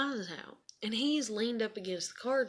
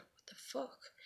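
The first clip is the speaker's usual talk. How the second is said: whispered, much softer, in disbelief; stunned whisper; 5 semitones higher, 12 dB quieter, slower than usual. Retold or imitated. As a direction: breathe it out in shock. Shocked reaction to surveillance news.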